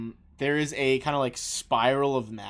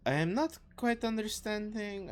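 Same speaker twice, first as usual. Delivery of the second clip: put-on higher-pitched, puzzled French accent; 8 semitones higher than usual. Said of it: "the French accent".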